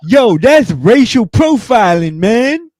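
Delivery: hammed-up outrage at being profiled; mock indignant customer. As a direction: customer accusing staff, mock offended and high.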